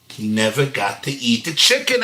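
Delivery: plain reading voice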